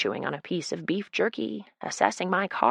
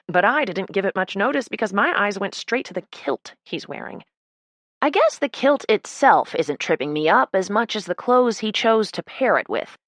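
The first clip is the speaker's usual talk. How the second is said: ordinary delivery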